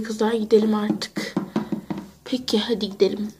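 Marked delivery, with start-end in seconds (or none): 2.2-3.3 soft unvoiced whisper. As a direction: whisper to slip away. Sneaking away quietly.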